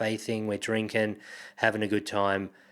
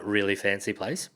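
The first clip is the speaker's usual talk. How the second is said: usual speaking voice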